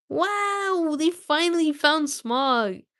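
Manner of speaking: high, slow delighted wow; thrilled at the big reveal; cheering the reveal to chat; excited. Real, not acted. Excited reading reaction.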